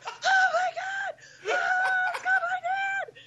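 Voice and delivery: high-pitched, drawn-out shriek; panicked child's scream. Retold or imitated. Child screams at dad's prank.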